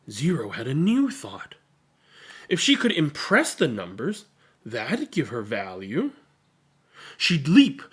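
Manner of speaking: put-on lively read-aloud for children; expressive picture-book reading; story narration. A narrator's reading. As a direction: read it to a room of kids, bright and animated.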